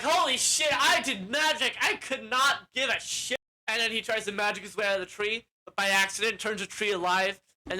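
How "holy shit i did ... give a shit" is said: high, excited mock boasting; amazed exclaiming. Tied-up wizard amazed he did magic, excited. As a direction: marvel at your own feat, voice raised.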